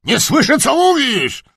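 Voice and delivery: very high, loud shout. A narrator's reading. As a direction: bellow that you can't hear her. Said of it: "huknął książę".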